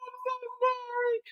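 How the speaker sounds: high mock sob, loud then thin; broken-down wailing. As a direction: wail the apology as if in tears. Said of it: "it broke me".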